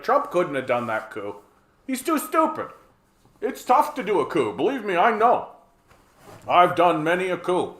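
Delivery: a hint of a boastful old hawk, pitch jumping high; lightly voiced smug official, casually confessing; playful mock bragging; lightly mimicked war hawk. Mimicking John Bolton boasting of coups.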